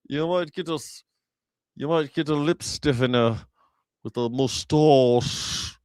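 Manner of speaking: mock posh English, lips spread at the bottom; lightly hammed stiff-upper-lip Brit; English accent. Streamer mocking English people's mouths, mild English accent. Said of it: "English people always seem to be talking".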